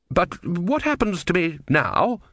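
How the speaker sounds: high, slow worried question; anxious plea. A narrator's reading. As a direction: ask with rising dread.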